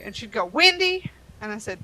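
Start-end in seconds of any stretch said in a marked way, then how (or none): 0.4-1.1 high, louder incredulous "Wendy?"; mock rude question. Rude customer mishearing the agent's name, high and pointed. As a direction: snap the wrong name back.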